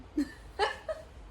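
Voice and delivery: breathless squealing giggle; giddy high laugh. Real, not acted. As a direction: burst into a high happy laugh. Joyful graduation announcement.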